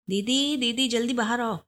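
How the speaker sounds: high-pitched call to big sister. A narrator's reading. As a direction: call her out, pitch lifted, not shouted. Sister called out from inside. (ऊँचे सुर में दीदी को पुकारना)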